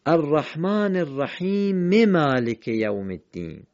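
slow recitation, الرحیم carrying a short e into مالک; linked verses. Shown as a demonstration. Teacher showing the short vowel, الرحیم linked slowly into مالک. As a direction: voice "rahimi maliki", no break between الرحیم and مالک. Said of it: "اینجا حرکت کوتاه رو خواندیم".

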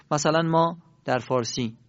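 ordinary talk, nothing marked

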